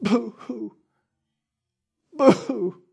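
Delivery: soft, breathy mock sob for help; sobbing boo-hoo. Shown as a demonstration. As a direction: demonstrate a weeping cry. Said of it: "something like this".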